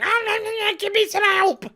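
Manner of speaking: hammed-up helpless cry; mock panicky Doctor.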